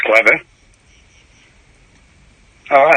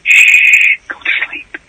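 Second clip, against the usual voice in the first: whispering; 5 dB louder, much slower than usual. Acted. Caller hushes host like a child.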